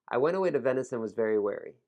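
brisk tongue twister, words run together; fast, reduced sounds. Shown as a demonstration. Teacher demonstrating a tongue twister for learners, said fast. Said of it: "when I say it fast".

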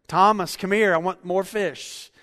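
teasing a congregant by voicing him; demanding church member. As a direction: bellow across the room like a hungry guest. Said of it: "If Brian Hill had been there".

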